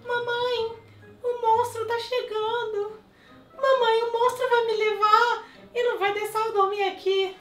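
very high, scared little puppy voice; Clarinha's voice. Acted. Dog Clarinha begging mom about the monster, high puppy voice. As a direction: whimper like a frightened puppy. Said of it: "fazer a voz da Clarinha".

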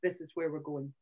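low, falling end on "going"; bullet-point it, dropping to a low "going"; final statement, pitch down on "going"; downward finish. Shown as a demonstration. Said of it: "When you go down, you're finishing".

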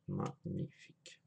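a slow breathy whisper of delight; whispered savouring. Real, not acted. Vaper sniffing his new e-liquid, whispering in delight.

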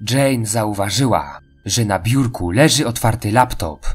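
ordinary talk

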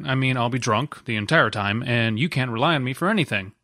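ordinary talk, nothing marked

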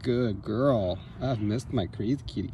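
ordinary talk, nothing marked

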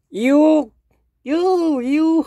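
very high, loud sing-song call; calling the dog. Real, not acted. Speaker calling his dog Yo, high and loud. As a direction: coo the dog's name up high.

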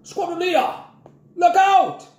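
sudden shout. A narrator's reading. Grandpa warning Jack of the matron, sudden shout. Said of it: "Suddenly Grandpa shouted".

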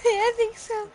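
high giggly answer; giggly reply. Real, not acted. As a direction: say it still giggling. Still laughing.

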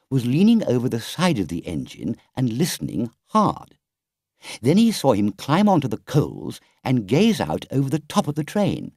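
ordinary delivery, nothing marked

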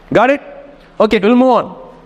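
ordinary talk

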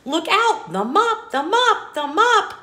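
alarmed warning. Acted. Book animals crying out as the mop returns. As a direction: warn them the mop is coming.

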